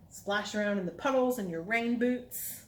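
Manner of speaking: ordinary talk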